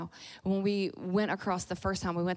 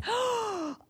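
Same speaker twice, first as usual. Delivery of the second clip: put-on high thrilled little-girl gasp; excited intake of breath; delighted gasp. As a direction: gasp as if given a prize. Excited child offered to lead.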